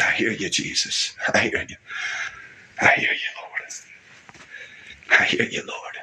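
choked, breathless whisper to the Lord; real tears, barely voiced; tearful whisper. Real, not acted. Overcome in prayer, tearful.

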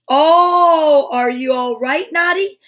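loud, high "oh" into a worried question; anxious call. A narrator's reading. Tessie checks on fallen Naughty, calling anxiously. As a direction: call out in story-time alarm. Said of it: "Tessie Bear called anxiously".

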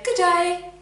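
high, loud cheery greeting over the fence; old lady's call. A narrator's reading. Old neighbour calling over the fence, high and loud. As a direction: call out a bright good day. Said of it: "She wanted to say".

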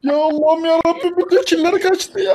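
high, whining lament; despairing wail. Real, not acted. Husband wails that every kill escaped, high whine. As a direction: moan to the heavens.

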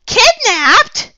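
very high, piercing shrieked question; girl's shrill, shocked scream of a question. A narrator's reading. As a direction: shriek it in shock at full volume. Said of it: "Ruth Rose shrieked".

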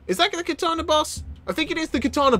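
high, astonished double take; surprised. Real, not acted. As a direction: recognise the boss in disbelief. Spotting the boss.

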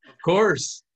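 put-on high, loud Colombian-accented reply. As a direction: imitate a priest's Colombian accent. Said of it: "do the Colombian accent that he does".

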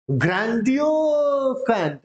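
ग्रैंडिलोक्वेंट stretched very slowly, pitched high; pronunciation demo. Shown as a demonstration. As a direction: draw out the middle of ग्रैंडिलोक्वेंट, high and slow. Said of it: "सिर्फ pronunciation करना है".

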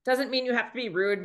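ordinary talk

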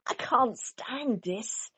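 very slow, drawn-out complaint; exasperated. A narrator's reading. Mister Bear in the noisy garden, complaining very slowly. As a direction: drag it out, fed up. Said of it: "said Mister Bear".

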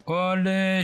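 high, loud cheer of effort; triumphant. Real, not acted. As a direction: push through the final shot. Finishing off zombie.

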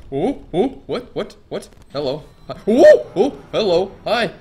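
high loud startled hellos; startled greeting. Real, not acted. Streamer startled by a living painting, high and loud. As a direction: nervously greet the thing.

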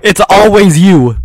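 slow, loud accusing yell. Real, not acted. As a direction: drag it out, loud and blaming. Accusing friend in game.

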